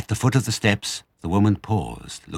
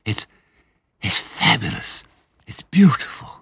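breathy, halting rapture; fighting for breath; 3 semitones higher than usual. A narrator's reading. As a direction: gasp out each phrase after a fiery gulp. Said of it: "fighting for breath".

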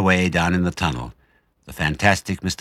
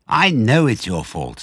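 slow, loud furious retort; 6 semitones higher, 5 dB louder, slower than usual. Acted. Badger blaming Fox, slow furious. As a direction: throw the blame back. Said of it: "said Badger furiously".

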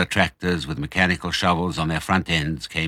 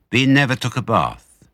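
ordinary delivery

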